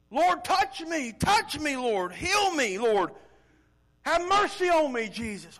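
high, stretched, loud plea; crying out for help. Acted. Blind beggar calling to Jesus for mercy, loud cry. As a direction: call out slowly and desperately. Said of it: "he called out to him for help".

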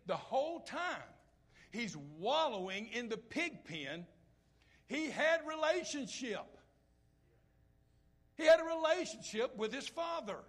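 rising, high and loud preaching climb; preaching at full force. Real, not acted. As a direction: build it up and drive it home. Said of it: "preaching climax, high and loud".

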